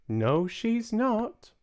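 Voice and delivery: put-on high, slow, unruffled old lady voice; calm grandmother. Grandmother correcting Mister Jenkins, slow high calm voice.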